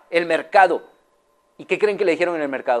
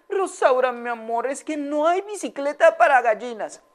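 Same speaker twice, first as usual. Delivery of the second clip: gentle comfort; 9 semitones higher than usual. Acted. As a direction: break the bad news gently. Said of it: "le dijo".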